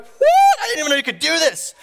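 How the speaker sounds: high-pitched incredulous yelp; shocked. Retold or imitated. Husband seeing wife's praying mantis pose, shocked. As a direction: shriek it in disbelief.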